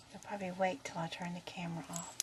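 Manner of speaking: whispered, quick aside. Real, not acted. Owner whispering an aside about her parrot while filming. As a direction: whisper it so the bird won't hear.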